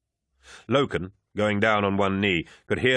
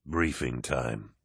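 chuckling lord; 7 semitones lower, 5 dB quieter, much slower than usual. Acted. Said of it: "Horus chuckled".

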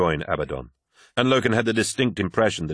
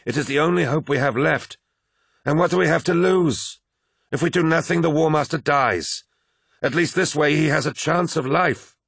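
higher-pitched, urgent character voice; pleading comrade; 9 semitones higher, 3 dB louder than usual. Acted. Arguing the War Master's only hope, pleading. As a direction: argue earnestly, pitched up.

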